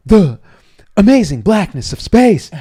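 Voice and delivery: high, loud, dramatic Shatner voice; hammed-up Shatner. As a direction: grandstand for the press like Shatner. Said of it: "Shatner's a drama queen".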